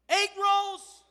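loud comic afterthought "Egg rolls"; egg rolls blurted. Shown as a demonstration. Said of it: "I wasn't even gonna say that".

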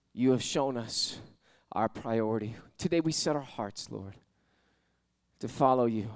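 low, hushed prayer with long pauses; closing prayer. Real, not acted.

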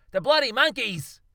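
high, loud alarmed yell. Acted. Australian Charles Darwin hears the monkeys escaping, yelling. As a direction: shout the alarm. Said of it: "he yelled".

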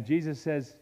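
ordinary talk

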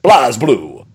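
booming game announcer. Retold or imitated. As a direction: call it like a title screen. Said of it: "they have the announcer voice".

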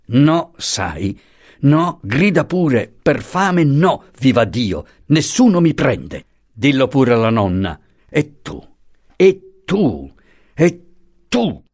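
a rising furious tirade; angry outburst. Acted. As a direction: fling it back at him, rising. Franco's angry outburst at Pasotti.

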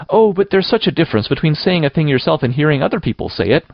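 put-on high, plaintive girl's wail; wailing protest. A narrator's reading. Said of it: "wailed Anne".

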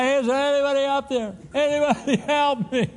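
high, strained call for help from a cliff; a dangling climber crying out for anyone, pitched high; desperate cry for help. Retold or imitated. Mountain climber calling out into the void, high and desperate. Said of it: "He's crying out about".

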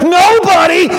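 loud, high triumphant answer; exultant shout. Real, not acted. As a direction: belt it out, boasting. Rousing call-and-answer boast about God.

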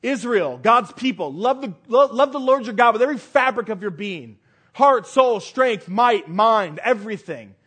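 loud, impassioned plea to love God; fervent preaching. Acted. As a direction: press it on the people with full force. Preacher urging the congregation.

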